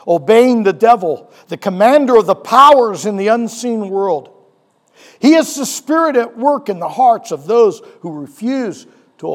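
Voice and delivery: loud, driving sermon delivery; fired-up pastor telling the Lazarus story; preaching at full force. Acted. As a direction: preach it out to the congregation. Preaching Ephesians to the congregation.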